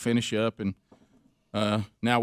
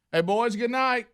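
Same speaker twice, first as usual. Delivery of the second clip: calling out goodnight; 11 semitones higher than usual. Retold or imitated.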